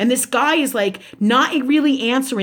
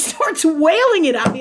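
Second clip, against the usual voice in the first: usual speaking voice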